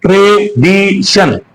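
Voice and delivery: Tradition slowly in three beats, tra, di, tion; slow, segmented tradition; split into syllables. Shown as a demonstration. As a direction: break tradition into three level, separate parts. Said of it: "तीन हिस्से हो गए".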